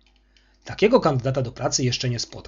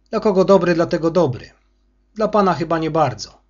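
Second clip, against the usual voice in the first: louder, higher, sneering sour welcome; sour greeting; 4 semitones higher, 6 dB louder than usual. Acted. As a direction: greet him with a wry, spiteful twist. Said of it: "krzywo przywitał się kurator".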